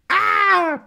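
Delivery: high-pitched cry of a man tripping; falling cry. Acted. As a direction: cry out as you trip over. Man trips and falls.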